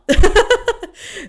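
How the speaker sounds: loud high burst of laughter; real laugh. Real, not acted. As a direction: laugh it off at the irony. Laughing at the rejection text.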